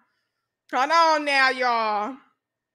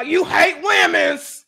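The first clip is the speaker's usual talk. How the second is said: slow, loud mocking yell; mock shouting critic; 2 semitones higher, 4 dB louder, slower than usual. Streamer mocking critics with a slow loud yell. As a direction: ham up an outraged online critic.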